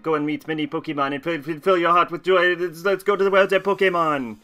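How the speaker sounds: put-on French-accented game professor, lively and building. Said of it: "I talk slower with the French accent".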